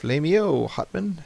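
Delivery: lightly hammed Fire Nation hail; mock archaic greeting. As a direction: toss off the greeting as a joke. Repeating the cartoon greeting.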